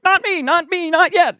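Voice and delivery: very high-pitched comic squeal of alarm; mock panic. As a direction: squeal it as if caught changing. Acted panic at being caught undressing.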